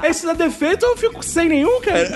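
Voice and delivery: laughing while joking; talking through laughter. Real, not acted.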